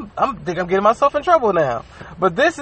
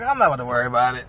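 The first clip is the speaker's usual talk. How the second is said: unbothered inner voice; 8 semitones lower, 4 dB quieter, faster than usual. Retold or imitated. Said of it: "in my mind, I'm like".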